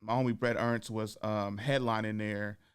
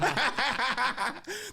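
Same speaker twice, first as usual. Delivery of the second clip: burst of laughter; 12 semitones higher than usual. Real, not acted. Caught out about cancel fear.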